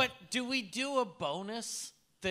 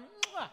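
high kiss sound; 10 semitones higher, 4 dB quieter than usual. Shown as a demonstration. Demonstrating the kiss.

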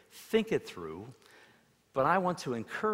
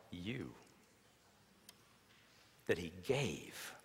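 slow, soft reading with long pauses; hushed, unhurried scripture; reverent scripture reading; 3 semitones lower, 8 dB quieter, slower than usual. Acted. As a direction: let each word of the verse land quietly. Reads John three sixteen reverently.

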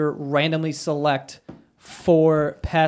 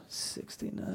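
hushed, voiceless echo; whispered aside; 11 semitones lower, 19 dB quieter, slower than usual. Real, not acted. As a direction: whisper it to yourself in disbelief. Repeats the age in aside.

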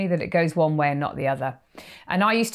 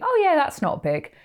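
high, airy shrug-off; mock-careless surfer; dismissive; 11 semitones higher than usual. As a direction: brush it off, casual and high. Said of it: "You'd think".

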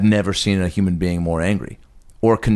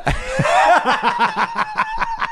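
cackling laugh at the pig name. Real, not acted. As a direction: burst of high-pitched laughter.

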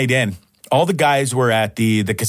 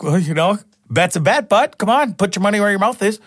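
imitating buddy; 7 semitones higher than usual. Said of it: "he goes".

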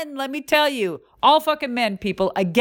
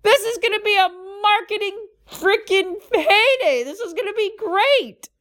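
higher, gleeful imitation of the friend; mock-cheer like she smells a marketing win; excited friend; 11 semitones higher than usual. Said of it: "my friend yells out".

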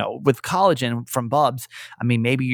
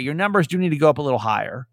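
normal talking voice

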